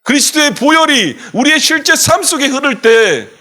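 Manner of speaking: high, loud fervent proclamation; preaching at full force. Acted. Pastor rousing the congregation to say amen, loud and fervent. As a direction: shout the victory to the church.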